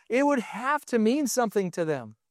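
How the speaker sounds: normal talking voice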